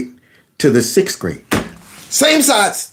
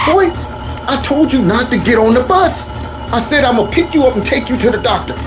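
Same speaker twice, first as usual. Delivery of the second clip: scolding mama; 5 semitones higher than usual. Retold or imitated. Mother scolding from the car.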